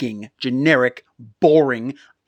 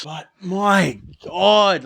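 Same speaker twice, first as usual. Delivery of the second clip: drawn-out exasperation; 6 semitones higher, 5 dB louder, much slower than usual. Real, not acted. Exasperated rant.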